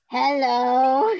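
troll voice. Retold or imitated. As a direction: belt it like a cartoon troll. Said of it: "And then a troll goes".

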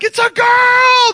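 high, loud, drawn-out cheer; elated shout. Retold or imitated. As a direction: shout the birth news to a party. Announcing the birth to partying family.

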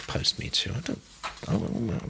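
doubtful, half-whispered question; sceptical. Real, not acted. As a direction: query the phrase quietly, unconvinced. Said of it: "I wonder if we are".

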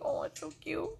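high, soft cooing; doting baby talk. Retold or imitated. Grandma cooing over toddler comforting baby, high and soft. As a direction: coo it like a smitten grandma.

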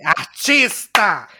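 loud, long-drawn mock proclamation; emphatic proclamation. Friend re-enacting a verdict given to her, loudly proclaimed. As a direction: stretch the word out like a grand announcement.

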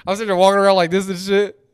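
high, loud, laughing through words. Real, not acted. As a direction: crack up while telling it. Laughing retelling hot dog plate.

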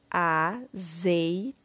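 AZEITONA broken slowly into a, zei, to, na; syllable by syllable. Shown as a demonstration. Teacher completing the word with syllable tó, slowly syllable by syllable. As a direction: sound out AZEITONA with gaps between syllables. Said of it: "Iremos utilizar a sílaba tó".

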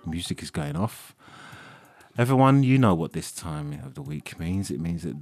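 forced through clenched teeth; through gritted teeth. Acted. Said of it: "Why are you saying it through clenched teeth".

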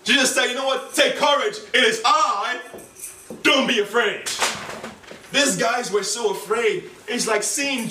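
high, loud preacher's cry; full-force preaching. Acted. Preacher proclaiming Jesus' words to the congregation. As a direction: proclaim it to the church.

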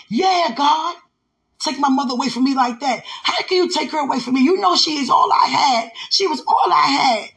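high, loud, tearful outcry; grieving woman crying out; crying out to God. Retold or imitated. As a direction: wail at God over a lost mother. Grieving woman confronts God, retold.

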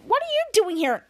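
shadow voice. Retold or imitated. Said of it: "a priest shadow said".

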